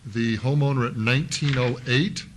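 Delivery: ordinary talk, nothing marked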